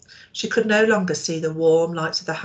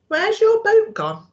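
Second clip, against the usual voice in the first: very high, louder startled question; sudden alarm at the empty mooring; shocked exclamation; 7 dB louder than usual. Acted. Alarmed discovery in story.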